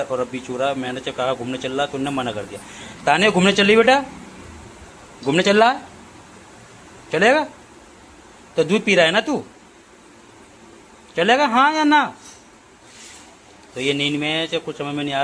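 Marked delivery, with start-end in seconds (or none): 3.1-12.1 sing-song pet talk. Real, not acted. Owner coaxing his sleepy pet to go for a walk, high sing-song baby talk.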